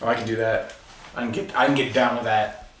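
normal talking voice

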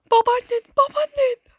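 high, loud taunting shout; frustrated shout. Real, not acted. Yelling at game in frustration.

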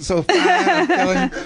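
laughing through the words, voice jumping up; laughing praise. Real, not acted. Host to guest, gushing with laughter about a patient. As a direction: gush with a laugh about how fine she looks.

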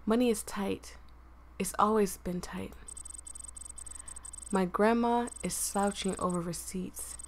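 quiet, hushed read of a sad story; soft story narration. Acted. Reads game story narration softly.

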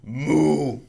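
big, loud cow moo. Acted. Reader demonstrates a loud moo for a picture book, loud. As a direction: moo out loudly.

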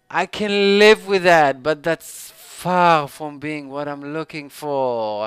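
loud, slow and drawn-out complaint; exasperated protest. Real, not acted. Gamer judging a so-so artifact, loud exasperated protest. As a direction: start high and loud, stretch it out.

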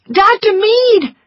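high, slow calling cry; cried out. A narrator's reading. Scarlett calling for the doctor among the wounded, loud cry. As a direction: call his name across the field. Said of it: "cried Scarlett".

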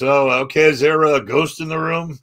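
eye-rolling skepticism. Retold or imitated. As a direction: ask it as a skeptic humoring believers. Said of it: "I'm kinda rolling my eyes".

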